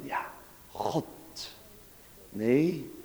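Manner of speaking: ordinary talk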